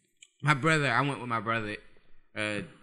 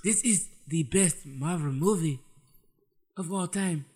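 a light mock Wakandan accent; lightly put-on Wakandan accent; 2 semitones higher than usual. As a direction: say it like a proud Wakandan. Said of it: "in Wakandan accent".

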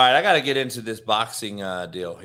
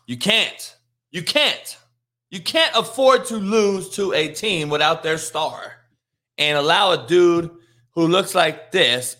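higher and louder, CAN'T hammered each time; escalating rant; 6 semitones higher, 4 dB louder than usual. Real, not acted. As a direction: build the outrage line by line. Escalating rant about the Celtics.

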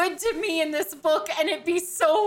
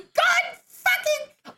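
exasperated outburst; 13 semitones higher, much slower than usual. Real, not acted. Frustrated outburst at the author.

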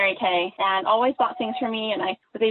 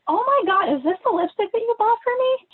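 high-pitched, retold gasp of disbelief; astonished reaction; 12 semitones higher, 3 dB louder than usual. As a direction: act out stunned recognition, voice way up. Reacting to gift in retold scene.

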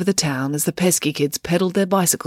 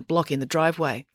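plain reading voice

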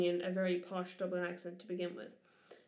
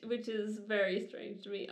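usual speaking voice

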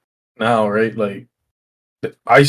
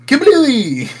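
high explosion shout; 11 semitones higher than usual. Retold or imitated. Explosion sound in story.

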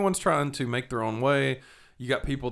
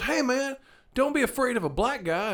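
much higher mock voice calling after him; imitated street hustler; 13 semitones higher than usual. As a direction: call after someone walking past you.

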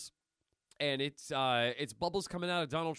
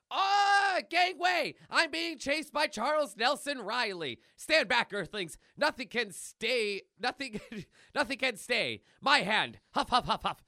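high, loud cartoon panic; breathless mock-heroic getaway voice; frantic comic character; 7 semitones higher, 4 dB louder than usual. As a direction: shout it like a hammed-up chase scene. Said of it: "And you hear".

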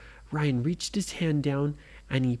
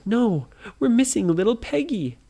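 worried call; 9 semitones higher, 5 dB louder than usual. A narrator's reading. Adults notice missing child.

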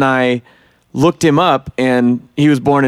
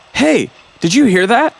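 high-pitched mock query, as if catching something; retold sudden noticing; 10 semitones higher than usual. Host acts out his wife catching something in the film, retold sudden noticing. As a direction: imitate someone perking up at an odd detail.

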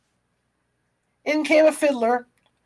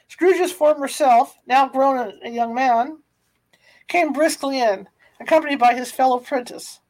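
plain reading voice

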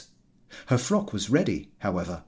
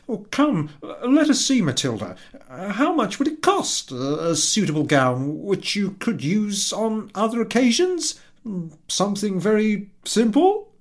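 much higher, louder pleading husband; trying to soothe her; coaxing husband; 10 semitones higher, 5 dB louder than usual. Acted. Husband to wife about a gown, much higher and coaxing.

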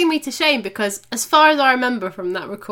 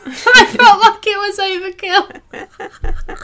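laughing; 8 semitones higher, 8 dB louder, much slower than usual. Real, not acted. Laughing at her own hello.

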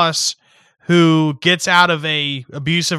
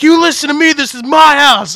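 much higher and louder, domineering; imitated bailiff yell; 12 semitones higher, 10 dB louder than usual. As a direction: shout like a jail guard claiming his turf. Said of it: "he's like".

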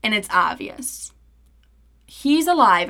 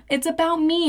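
mock self-centred whine; 8 semitones higher than usual. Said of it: "I'm over here like".

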